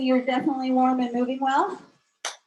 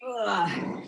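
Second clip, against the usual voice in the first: breathy strained grunt; effort grunt; 3 semitones lower than usual. Real, not acted. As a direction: grunt through the last rep. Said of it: "Sorry for the grunting".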